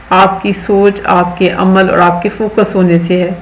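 usual speaking voice

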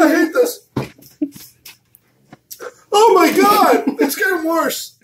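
very high, squealed complaint; real pained whine. Real, not acted. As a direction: voice shooting up from the burn. Burn of the chip growing worse.

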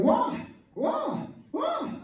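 very high martial-arts cries; Bruce Lee. Retold or imitated. Preacher imitates a movie fighter for laughs, high kung fu cries. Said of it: "Bruce Lee always wins the fight".

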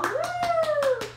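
bright, loud little whoop of praise; excited cheer. Real, not acted. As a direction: cheer her on. Dog found the toy.

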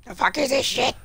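high startled question; shocked squeak. Real, not acted. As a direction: shriek your confusion up high. Sees something weird in game.

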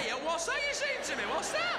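rising in disbelief; baffled customer at the pie shop till. Retold or imitated. Baffled reply.

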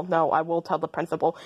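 usual speaking voice

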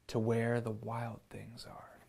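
put-on hushed whisper to the children; suspenseful whisper. A narrator's reading. Narrator building suspense for kids, whispering. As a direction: drop to a secret whisper.

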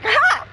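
loud, high barking "ha"; sudden high mock bark, breaking into laughter; imitated dog bark. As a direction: mimic a startled dog's bark. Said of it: "He's like".

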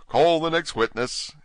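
high, slow courtroom call; relieved, drawn-out summons; relieved King. A narrator's reading. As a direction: call it out, glad to move on. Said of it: "with an air of great relief".

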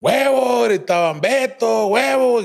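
high mock yell from outside the gate; calling out. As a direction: call his name up at the window. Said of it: "gritaban machín".

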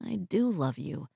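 low, soft confession; tearful sincerity. A narrator's reading. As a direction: say it softly, close to tears. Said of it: "His eyes filled with tears".

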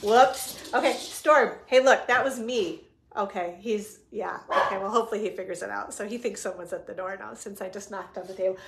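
usual speaking voice

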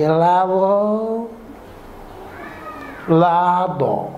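pregón lento y flojo de helado; vendedor desganado. Acted. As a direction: arrastra helado sin ganas, muy lento. (pregón flojo y lento de vendedor sin ganas)

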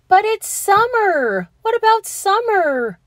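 a little brother calling out in dismay. A narrator's reading. Little brother calling after Tilly, high and loud. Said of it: "her brother called".

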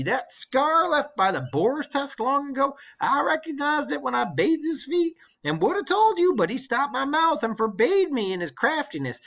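put-on an old servant bursting with good news; old nurse, excited. Old nurse telling her queen the suitors are dead, eager and high.

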